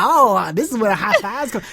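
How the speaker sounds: put-on high, wide-eyed little kid voice; awed kid. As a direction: sound amazed like a small child. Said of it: "He's like".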